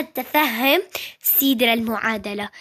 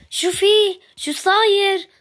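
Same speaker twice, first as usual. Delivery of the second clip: drawn-out, higher and louder excited call; 7 semitones higher, 3 dB louder, slower than usual. Acted. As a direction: stretch it out, bursting with news. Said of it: "وليش صوتك طالع؟". (نداء متحمس عالٍ وبطيء)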